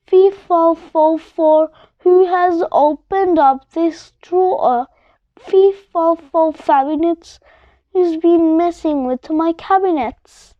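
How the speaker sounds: plain reading voice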